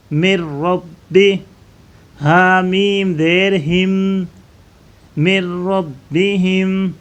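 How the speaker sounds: مر رب بی ہم spelled one slow syllable at a time; teacher spelling مر رب بی ہم step by step, slow and firm. Shown as a demonstration. Teacher breaks ربہم into syllables for pupils, slow spelling. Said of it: "میم کو را کے ساتھ ملائیں".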